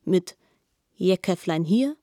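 normal talking voice

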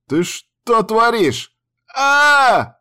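high, drawn-out panicked yell; screaming while falling. A narrator's reading. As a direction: scream as he drops off the roof. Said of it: "заорал мужик и полетел вниз".

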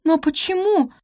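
high-pitched, disbelieving plea; shocked. A narrator's reading. Maid asks why she is thrown out, dismayed. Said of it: "ахнула она".

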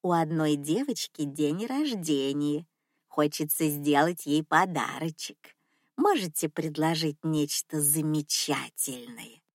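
low, soft cooing grandma voice; cooing old lady. Acted. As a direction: sweet-talk like a wealthy old lady. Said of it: "проворковала старушка".

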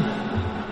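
faint voiceless laugh; breathy ghost laugh. Acted. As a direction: ghost chuckling away. Hồn ma cười. (tiếng ma cười thì thào)

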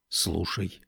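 quiet, low single word; hushed alert. A narrator's reading. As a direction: hush them so they listen. Hushing companion to hear a sound.

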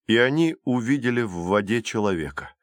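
ordinary delivery

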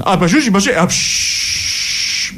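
high-pitched grammelot gibberish; mock grammelot outburst with noises; Dario Fo. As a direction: order coffee in theatrical nonsense, ending in a hiss. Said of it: "va a ordinarsi un caffè al bar in Gramblò".

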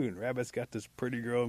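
usual speaking voice

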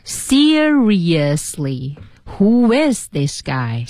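Seriously very slowly syllable by syllable, IS emphasised; careful repeat, lengthened is in who is; stress on IS. Shown as a demonstration. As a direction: stretch seriously, lean on is. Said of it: "在is上加重音".

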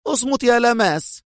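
high, sharp command; cutting order. A narrator's reading. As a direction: snap at him to shut up. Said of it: "قالت لوتشيا في حدة".

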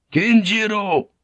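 high, loud defiant shout. A narrator's reading. Blackthorne defying Omi from the ladder's foot, shouted. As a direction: shout it as a command. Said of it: "he shouted".

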